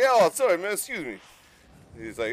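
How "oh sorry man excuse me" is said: mock Italian. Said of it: "Italian guy's like".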